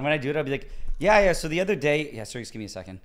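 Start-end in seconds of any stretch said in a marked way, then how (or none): 2.1-3.0 soft distracted mumble; muttering. Shown as a demonstration. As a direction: trail off into a mutter. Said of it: "You kinda, like, start muttering".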